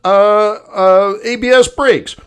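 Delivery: high bing chimes, then a slow robotic readout; car warning voice. Retold or imitated. The car's computer listing lost systems, chiming high. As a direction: play the dashboard announcing failures. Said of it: "it's like".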